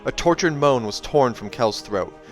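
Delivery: plain reading voice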